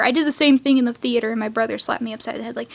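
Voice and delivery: ordinary talk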